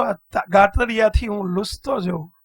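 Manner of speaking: normal talking voice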